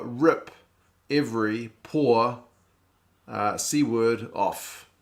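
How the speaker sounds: slow, deliberate acronym reveal, each word separated; spelled out slowly. Shown as a demonstration. Streamer decoding the Repco name for chat, slow and deliberate. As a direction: lay out Rip Every Poor word by word, slowly. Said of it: "Repco stands for".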